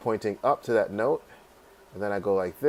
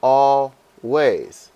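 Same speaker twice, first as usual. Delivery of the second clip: "Always" loudly broken as al, pause, ways; split into syllables; 4 semitones higher, 8 dB louder than usual. Shown as a demonstration. Teacher showing where the dash goes in "Always", syllable by syllable. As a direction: say "Always" in two slow, separate beats. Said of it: "put dashes in between the syllables".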